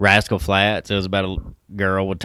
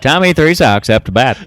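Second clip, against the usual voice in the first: put-on old radio announcer; 4 semitones higher, 7 dB louder than usual. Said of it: "make me sound like an old radio announcer".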